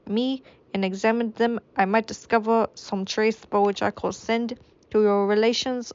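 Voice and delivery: a wavering, hammed-up French accent; a French accent slipping away. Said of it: "I don't even think this is French anymore".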